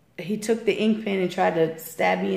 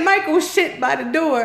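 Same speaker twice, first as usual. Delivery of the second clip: a lightly voiced kid repeats it, higher and louder; a child says it again, more insistent; child repeating louder; 8 semitones higher, 5 dB louder than usual. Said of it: "he said".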